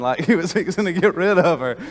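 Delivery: chuckling through the words; laughing. Real, not acted. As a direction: let the laugh spill into it.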